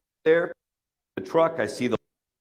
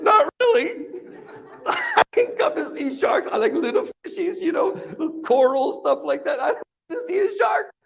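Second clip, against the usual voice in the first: much higher, laughing fear; high, scared squeak; 11 semitones higher than usual. Retold or imitated. Diver dreading the shark, in a high laughing squeak. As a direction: squeak it out like a frightened kid. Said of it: "And I'm like".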